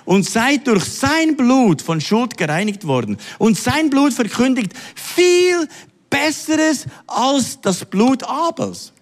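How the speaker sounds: slow, high, drawn-out reading; solemn scripture reading. Acted. Preacher reading Hebrews to church, solemn. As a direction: stretch the promise out. Reads scripture slowly, lifted.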